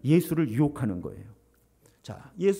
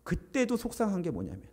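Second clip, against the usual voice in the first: ordinary talk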